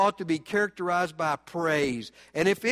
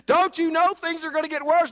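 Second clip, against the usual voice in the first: mock alarmed onlooker; 9 semitones higher, 5 dB louder than usual. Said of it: "imagined onlooker asks".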